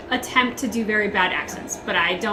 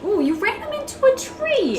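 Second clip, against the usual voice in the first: high startled ooh; alarmed tease; 14 semitones higher than usual. Real, not acted. Co-host teasing the player about the tree crash, high startled. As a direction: squeal at the crash.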